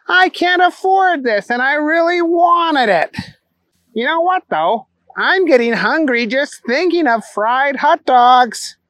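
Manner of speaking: high, silly simpleton voice; Booger. Acted.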